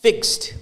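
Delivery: ഫിക്സ്ഡ് with the E swallowed; teacher modelling ഫിക്സ്ഡ് as one clipped syllable; correct fixed. Shown as a demonstration. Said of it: "we just swallow that E out".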